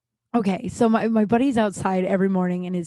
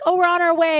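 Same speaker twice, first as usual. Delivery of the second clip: higher, louder chirpy announcement; cheery phone update; 10 semitones higher, 8 dB louder than usual. Retold or imitated. Buddy calling to say they are coming, cheery. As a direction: sing it out brightly down the phone.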